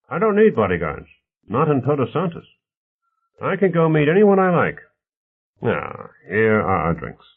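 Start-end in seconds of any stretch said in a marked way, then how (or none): 0.1-4.8 lightly voiced protest, higher in pitch; a touch of indignant insistence; defensive mayor's man. Stevens rejects bodyguards to Bonner, mildly defensive character voice.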